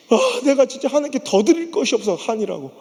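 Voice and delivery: usual speaking voice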